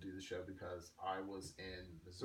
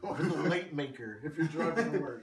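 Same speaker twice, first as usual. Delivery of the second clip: laughing through the words; 5 semitones higher, 11 dB louder than usual. Real, not acted. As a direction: giggle while joking. Joking while laughing.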